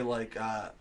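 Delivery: ordinary talk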